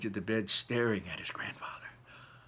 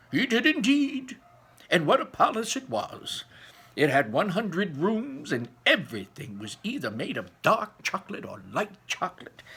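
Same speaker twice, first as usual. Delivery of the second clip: higher, louder storyteller grandpa, marvelling; Grandpa Joe telling; 6 semitones higher, 4 dB louder than usual. A narrator's reading. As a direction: paint the wonder for the boy, ending in a hush. Grandpa Joe tells the tale.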